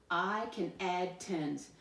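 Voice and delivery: slow, even group-reading pace; slow read-along. Shown as a demonstration. Teacher leads pupils reading the statement together, slow read-along. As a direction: lead a slow read-aloud for others to join. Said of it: "Read it with me this time".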